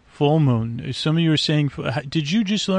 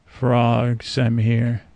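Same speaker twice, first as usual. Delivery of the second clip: ordinary delivery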